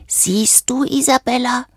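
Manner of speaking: hushed, high, hoarse character voice. A narrator's reading. Valentino to Isabella after clearing his throat, hoarse. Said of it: "sagte er heiser".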